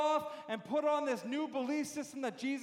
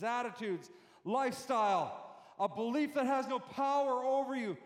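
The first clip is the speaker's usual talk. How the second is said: usual speaking voice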